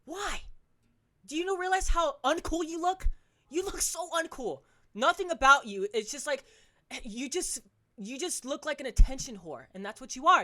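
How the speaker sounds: high-pitched, loud, scornful ranting; angry high rant. Real, not acted. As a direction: shout your disgust at them. Ranting at drinking teenagers.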